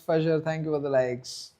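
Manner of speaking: usual speaking voice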